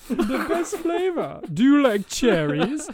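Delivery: high, loud, laughing; hammed-up sweet doctor voice; mock doctor. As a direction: coax like a creepy doctor. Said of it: "And he said".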